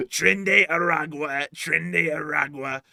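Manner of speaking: slow, drawn-out impression voice; Trinderagua voice. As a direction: stretch the word out like the impression. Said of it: "that Trinderagua voice".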